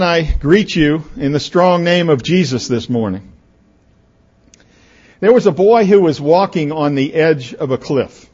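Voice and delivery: usual speaking voice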